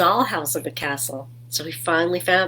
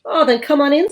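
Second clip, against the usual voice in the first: high, louder welcome; little royal voice; 9 semitones higher, 6 dB louder, faster than usual. Acted. The little king and queen invite Gilbert, high cheery welcome.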